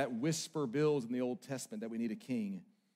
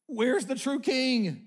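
louder, higher, slow shout; pleading loud call; crying out; 9 semitones higher, 8 dB louder, much slower than usual. Acted. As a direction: cry it out in longing. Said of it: "screaming at us".

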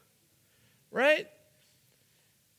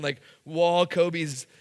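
ordinary talk, nothing marked

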